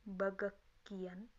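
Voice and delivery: slow "bagak kian" with a hard k in the middle; natural reading. Shown as a demonstration. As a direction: model "bagak kian" slowly for learners. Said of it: "atau bisa dibaca".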